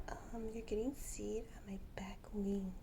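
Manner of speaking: soft whispered complaint about seed; whispered bird voice. Retold or imitated. Owner voicing her bird annoyed by seed, whispered. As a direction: whisper the bird's mild grumble. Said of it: "She's like".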